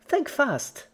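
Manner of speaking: very high, loud teasing cry; spring it on her gleefully; playful Pinky. Acted. Pinky teases before yanking.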